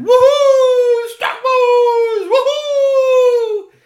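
high, loud hooting shout; baby owl hooting loudly to his friend; owl hooting cheer. Acted. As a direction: hoot a happy thank-you. Julio calls thanks to Strongbones.

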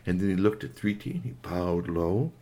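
ordinary delivery

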